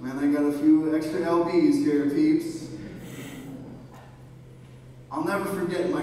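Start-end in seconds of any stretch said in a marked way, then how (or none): 0.0-2.7 deep, jokey self-mocking grumble; low mock grumble. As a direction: drop the voice low and tease yourself. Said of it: "I look in the mirror and I'm like".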